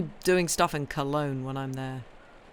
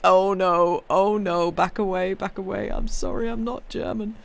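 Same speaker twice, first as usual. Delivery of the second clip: mock panic; 4 semitones higher, 5 dB louder than usual. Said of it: "at which point I'm like".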